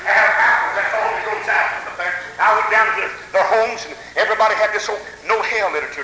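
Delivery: ordinary delivery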